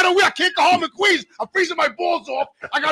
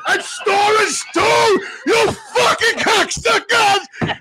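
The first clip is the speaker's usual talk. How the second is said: outraged shout; 6 semitones higher, much slower than usual. Retold or imitated. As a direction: drag it out in disbelief. Echoes clerk in outrage.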